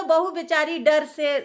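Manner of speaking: ordinary delivery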